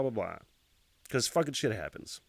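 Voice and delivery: usual speaking voice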